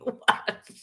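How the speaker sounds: ordinary talk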